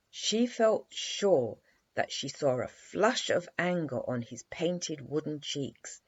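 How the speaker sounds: ordinary delivery, nothing marked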